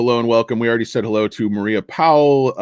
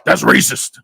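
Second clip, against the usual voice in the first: louder, higher comic objection; mock indignant; 7 semitones higher, 5 dB louder than usual. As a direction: object in a put-on character voice. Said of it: "He's like".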